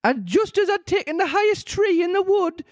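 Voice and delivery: pigeon complains about serpents; high pigeon voice. A narrator's reading.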